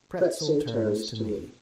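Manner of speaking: usual speaking voice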